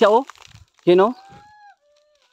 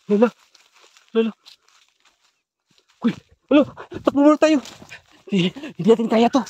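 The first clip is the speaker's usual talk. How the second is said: high frantic yell to run; panicked shout; 7 semitones higher than usual. Real, not acted. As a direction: scream it while fleeing. Fleeing the witch in panic.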